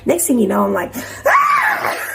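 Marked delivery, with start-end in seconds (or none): 1.2-2.1 high, shrill boxing-class yell; sudden yell. Retold or imitated. Shouting out during a boxing class. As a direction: let out one big cry. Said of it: "And I just yell".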